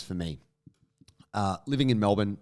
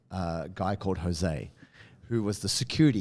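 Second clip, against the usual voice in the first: normal talking voice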